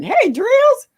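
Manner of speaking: very high, loud hello; calling out. Real, not acted. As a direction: shout a happy hi across the room. Greeting a viewer in chat.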